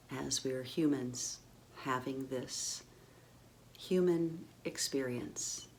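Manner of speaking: calm meditation voice, long pauses between phrases; guided meditation. Real, not acted. Guide settles listeners into their physical bodies, calm meditative. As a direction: gently lead them into the body, unhurried.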